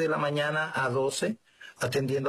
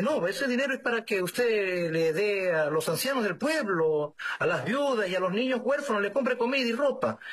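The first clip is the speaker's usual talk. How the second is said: higher-pitched, earnest explaining; earnest insistence; 8 semitones higher than usual. Retold or imitated. Donor explains the money to the priest, earnest insistence. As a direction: list it out, pitched well up.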